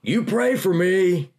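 high, loud call over the heads of a crowd; yelled across the crowd. Retold or imitated. Prisoner calling to the departing chaplain, loud yell. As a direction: shout it after him, stretching the words out slowly. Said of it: "He yelled at me".

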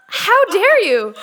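hammed-up triumphant outrage; mock indignant yell. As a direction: fling it after the car as she drives off. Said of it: "And I'm like".

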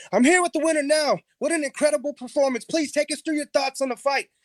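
mock ringside host, brisk and showy; Joe Rogan. EP mocking the gang as a fight announcer, hammed-up interviewer. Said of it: "he starts to speak like Joe Rogan".

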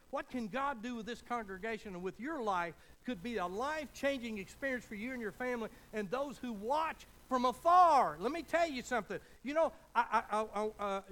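preaching at full force. Acted. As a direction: drive it home like a revival preacher.

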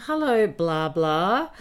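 loud, high sarcastic taunt; sarcastic loud greeting; mocking jab. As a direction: needle him loudly with a sneer. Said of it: "And I just said to him".

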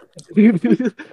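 high, loud burst of laughter; a loud, high disbelieving laugh; incredulous laugh. Real, not acted. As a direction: laugh out at how absurd it is. Laughing at joke accusation.

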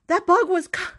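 high, loud mock panic; panicked self-defence. Defends panic over the bug.

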